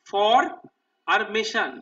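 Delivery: "ফর", pause, then "mason"; split into parts. Shown as a demonstration. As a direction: punch "ফর" loud, then break before "mason". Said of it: "তিনটে সিলেবলে ভাগ করে নেবো". ("ফর" আর "mason" আলাদা ভাগে, থেমে থেমে)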